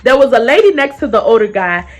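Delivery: plain reading voice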